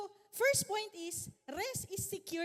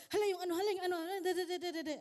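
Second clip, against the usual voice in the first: loud, higher, restless mock fret; fidgety inner panic; 4 semitones higher, 5 dB louder, much faster than usual. At the service, unable to sit still, loud restless fretting. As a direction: blurt it out like you can't sit still.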